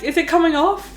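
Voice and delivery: high-pitched worried question, rising; retold freak-out. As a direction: act out anxious checking. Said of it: "I was freaking out".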